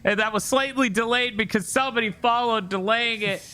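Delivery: high, hammed-up excited announcement; hyped raid shout-out. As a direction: greet a raider with showy, high-pitched excitement. Hyped greeting for a raiding streamer.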